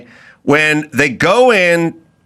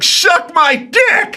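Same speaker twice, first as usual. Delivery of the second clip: much higher, slow mock yell of a furious woman; a softball woman yelling through the fence, hammed-up; angry woman yelling; 13 semitones higher, much slower than usual. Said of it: "a woman get really pissed off".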